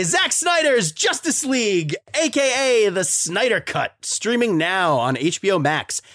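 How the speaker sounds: high-pitched, slow title announcement; announcer read. Acted. Host introduces the Snyder Cut to listeners, announcer read. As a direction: announce the film with big, measured emphasis.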